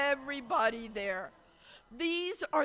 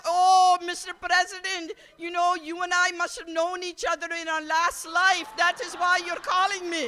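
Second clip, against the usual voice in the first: higher, louder, starry-eyed mom voice; imitating gushing mom; 5 semitones higher, 7 dB louder than usual. Mom gushing to the president on speaker, higher and louder. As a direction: gush like an overwhelmed fan.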